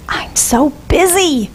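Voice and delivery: imitating a stressed person, sighing it out; a hint of weary busyness; mock harried complaint. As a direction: sound put-upon and overworked. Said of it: "how often do you hear or maybe even say".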